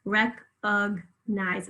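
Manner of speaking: Recognize very slowly as "rec-ug-nize"; syllable by syllable. Shown as a demonstration. Teacher points out the second syllable, syllable by syllable. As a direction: pause around the ug in Recognize. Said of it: "Second syllable".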